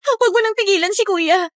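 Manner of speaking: usual speaking voice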